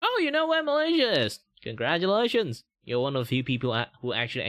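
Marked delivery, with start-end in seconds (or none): 0.0-2.6 high, loud gushing praise; mock excitement. Streamer to chat, hammed-up excited congratulations. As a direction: gush over viewers who know Malaysia.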